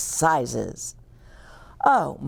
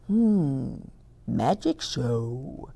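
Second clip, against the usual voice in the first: thinking aloud; 4 semitones lower, 4 dB quieter, slower than usual. Acted. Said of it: "thought the bear".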